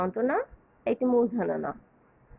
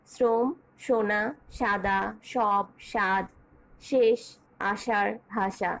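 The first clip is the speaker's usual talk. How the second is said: শ্রম, সোনা, সাদা each said slowly and carefully; slow example list; 3 semitones lower, slower than usual. Shown as a demonstration. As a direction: sound out each word slowly for the class. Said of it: "কোনো সয়ের উচ্চারণে কোনো পার্থক্য নেই".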